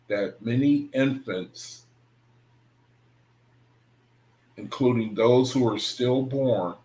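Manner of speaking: slow, deliberate reading with long pauses; slow, pausing reread; slow careful reread. Shown as a demonstration. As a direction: read it slowly, word by word, to understand it. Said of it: "Read it again".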